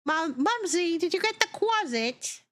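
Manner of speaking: put-on high pleading child calling mom; child tugging at mom's sleeve; whiny kid voice. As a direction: play the eager little kid asking mumsy. Kid voice in imagined cooking scene.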